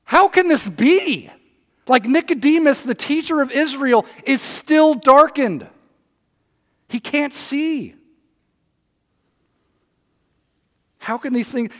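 slow, lightly voiced puzzled Nicodemus; a hint of a bewildered teacher; Nicodemus baffled. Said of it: "What is Nicodemus's response?".

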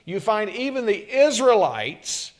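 loud, high, fervent pulpit voice; a preacher building to full force; full-force preaching. Acted. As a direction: preach it out to the whole room.

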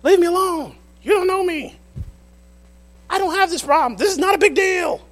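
put-on high, loud whiny teen protest; teen shouting at parents; sulky teenager. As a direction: play the defiant teenager yelling back. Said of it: "our parents are saying something".

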